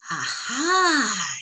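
high sudden exclamation; triumphant aha. A narrator's reading. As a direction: pounce on the discovery. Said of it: "Tio Santo exclaimed".

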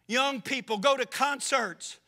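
loud, driving pulpit preaching; fiery preaching. Acted. Preacher warning his church against drink, loud and driving. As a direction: hammer each phrase home to the congregation.

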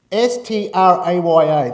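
loud, letter-by-letter spelling; emphatic spelling. Shown as a demonstration. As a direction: spell it out loudly for the room.

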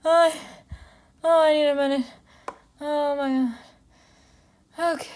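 panting between words after running to the mailbox; gasping, winded, can barely get words out; out of breath. Real, not acted. Back from a sprint to the mailbox, out of breath and gasping. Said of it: "now I'm out of breath".

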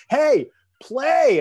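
loud, high imitated shout. Bandleader cueing a drummer who missed his entry, mock shouting. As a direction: yell it like an urgent cue to a lost drummer. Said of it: "[name]'s going".

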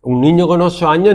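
ordinary talk

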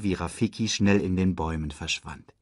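usual speaking voice

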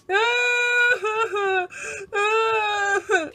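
hammed-up crying; theatrical bawl. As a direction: wail into the cup. Cries into his cup.

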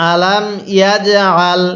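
"আলাম ইয়াজ আল" measured, bounced "j"; correct recitation. Shown as a demonstration. Teacher shows correct reading, measured "আলাম ইয়াজ আল". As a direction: press the throat on "আল" in "আলাম ইয়াজ আল". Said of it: "তাহলে কিভাবে পড়তে হবে".

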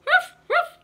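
high, loud yappy barking; dog bark. Acted. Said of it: "said the dog".

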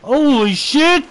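loud drawn-out startled cry; shocked shout. Real, not acted. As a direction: yell it in shock. Bear appears at his bike.

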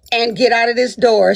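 ordinary talk, nothing marked

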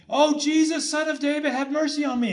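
high-pitched cry, held back in volume; mock screaming plea. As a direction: imitate a desperate scream without shouting. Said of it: "he started screaming".

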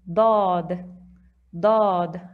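"Dad" with a heavy, dark, open-mouth d; thick emphatic dad. Shown as a demonstration. Teacher drilling the special Arabic letter dad. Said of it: "the language of Dad".

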